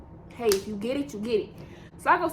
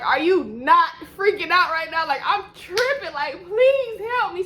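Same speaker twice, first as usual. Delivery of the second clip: pleading panic; 6 semitones higher, 5 dB louder, slower than usual. Retold or imitated. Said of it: "grab Madison and I'm like".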